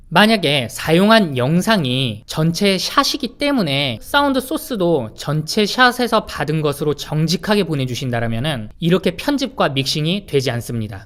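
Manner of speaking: normal talking voice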